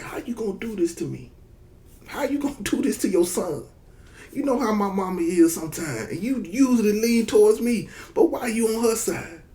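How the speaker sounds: put-on very high, soft, wounded little boy; hurt whiny kid. Son pleads with his dad to take his side, high soft whine. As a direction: whimper it high like a betrayed child.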